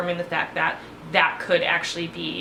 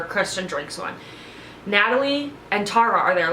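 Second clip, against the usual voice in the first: ordinary talk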